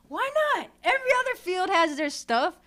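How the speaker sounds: high, loud, impassioned protest; fired-up indignation. Real, not acted. Barber arguing his trade deserves expos too, fired-up indignation. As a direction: argue it loudly, pitch jumping up.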